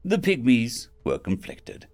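ordinary delivery, nothing marked

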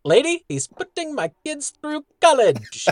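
high-pitched comic character voice; hammed-up, high put-on voice; quoted cartoon character. Acts out a cartoon character's line.

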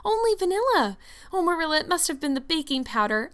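high-pitched, distressed excuse; mortified. A narrator's reading. Anne blames the baking powder to Marilla, mortified. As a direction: insist desperately, voice pitched high. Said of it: "her face scarlet with mortification".

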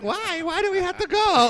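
high, bewildered mock whine; clueless friend. Friend being dragged away, high clueless whine. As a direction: squeak it out, utterly clueless. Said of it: "He's like".

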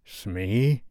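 drawn-out call, rising at the end; lightly voiced captain, questioning lift; captain character. A narrator's reading. Hook calling on his bosun, lightly voiced captain. Hook grows uneasy in story.

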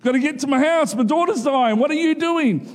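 an anxious father hustling Jesus along; impatient hurry-up. Pretend. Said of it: "I'd be like".

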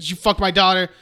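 hammed-up accusing mother; mock accusing. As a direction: repeat it, pushing harder. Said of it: "she's like".

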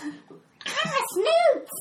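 very high, slow squawking bird voice; shrill screeching bird woman. Acted. GM repeats creature line.

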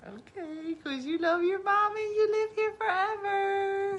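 high teasing singsong mimicking her kid; high mocking kid voice; mock baby voice. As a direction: tease in a high babyish voice. Mocking child clinging to mommy.

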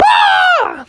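very high-pitched scream; hammed-up high yell of fright; Froggy's scream. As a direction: shriek in cartoon terror. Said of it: "And Froggy was like".

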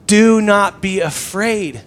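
"Do not be afraid" slow and loud, each word weighted; "Do not be afraid" firmly proclaimed at half speed; emphatic reassurance. Retold or imitated. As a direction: press "Do not be afraid" home, drawing out every word. Exhorting struggling listeners with Jesus' words.

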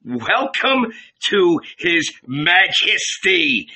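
slow, high-pitched mock announcement; mocking welcome. A narrator's reading. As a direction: greet him with exaggerated ceremony. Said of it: "could not keep from laughing".

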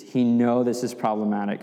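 ordinary talk, nothing marked

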